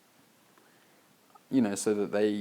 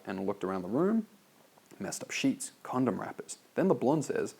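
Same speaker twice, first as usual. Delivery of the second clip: ordinary talk, nothing marked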